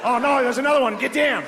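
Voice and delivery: a cartoon Australian spots danger; high mock Australian alarm; Australian panic. Voicing an Australian in office.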